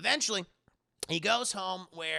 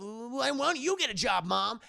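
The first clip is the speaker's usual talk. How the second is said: mock snap back; 5 semitones higher, 3 dB louder than usual. Yusuke snaps back at mom.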